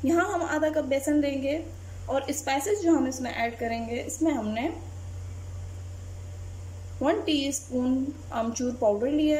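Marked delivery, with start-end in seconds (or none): none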